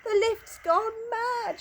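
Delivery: high, slow cry of alarm; alarmed shout. Acted. Grandpa Joe panics high in the sky, high slow alarm. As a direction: wail it out in disbelief. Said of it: "shouted Grandpa Joe".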